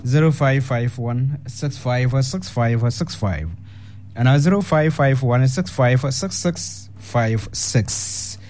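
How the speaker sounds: slow, measured number read-out; phone number announcement. Real, not acted. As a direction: announce the digits slowly, one by one. Reads out call-in phone numbers.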